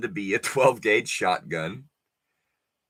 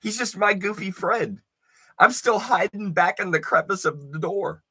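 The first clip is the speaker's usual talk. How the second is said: higher, giggly nervous excuse; goofy friend; 9 semitones higher than usual. Retold or imitated. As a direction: play it off like a grinning buddy. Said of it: "and he's like".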